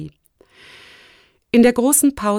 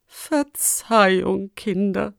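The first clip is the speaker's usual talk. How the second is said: feeble, very slow apology; weak voice; slower than usual. A narrator's reading. Exhausted rector apologising to the children, weakly. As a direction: let it fade away as if drained. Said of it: "mit schwacher Stimme".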